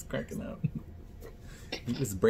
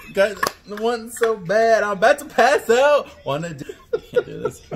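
rapping lyrics with delayed feedback; giggly, stumbling speech-jammer rap; after laughing hard; 5 semitones higher, 3 dB louder than usual. Real, not acted. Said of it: "Speech Jammer app".

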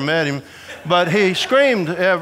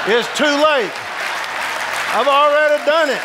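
put-on panicked child; 7 semitones higher than usual. Scared kid inner panic.

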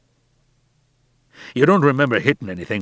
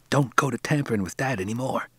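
softer, urgent pleading; 2 semitones higher, 5 dB quieter than usual. A narrator's reading. Son warning the new boy about Dad, softer pleading. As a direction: beg him to stop before it's too late. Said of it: "he pleaded".

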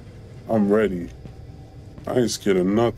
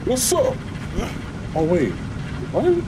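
startled; 10 semitones higher than usual. Real, not acted. Startled by a monster.

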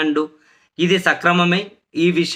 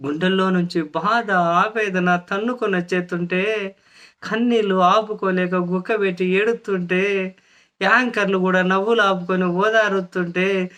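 mock lament; 4 semitones higher than usual. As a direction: stretch each phrase out in theatrical pity. Mocks a tearful on-air performance.

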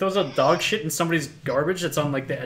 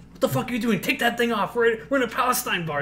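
urgent scolding in a higher voice; mock-frantic reprimand, pitched up; alarmed friend; 8 semitones higher than usual. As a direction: imitate a panicked friend telling him off. Acted friend scolding over yarmulke.

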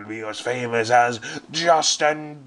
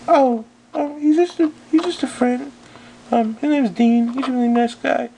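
put-on much higher, bubbly valley girl voice; girly voice; 13 semitones higher than usual. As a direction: play a ditzy girl, pitched way up. Stephanie flustered excuse.

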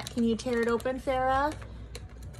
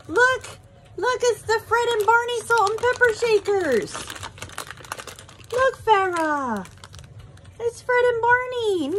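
high, loud, thrilled squeal; delighted opening a present; 10 semitones higher, 5 dB louder than usual. Real, not acted. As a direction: squeal over the gift. Finds salt and pepper shakers.

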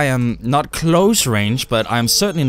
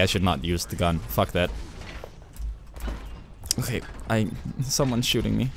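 normal talking voice

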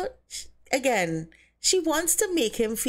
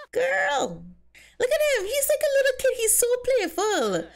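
giddy gushing; 14 semitones higher than usual. Real, not acted. Gushing over her Sim swimming.

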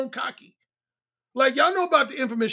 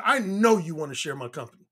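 low-pitched, understated cockiness; cocky; 7 semitones lower than usual. Retold or imitated. Man approaching Sharon with a cocky line, cocky. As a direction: retell a cocky pickup line, dropping the pitch. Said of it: "the most cocky thing I said".

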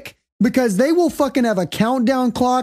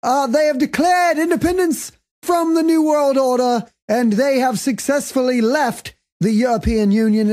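a fading fake British accent; mock British accent that keeps slipping; 3 semitones higher than usual. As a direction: keep the British accent going, loosely. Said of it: "I'm already losing my accent".

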